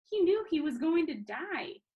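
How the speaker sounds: plain reading voice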